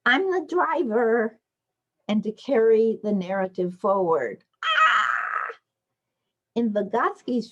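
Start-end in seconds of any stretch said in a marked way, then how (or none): 0.0-1.4 put-on high and slow, proud little kid; child's voice. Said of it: "to transform identities of people". 4.6-5.5 sudden high unvoiced shout; high breathy play yell; child's play shriek.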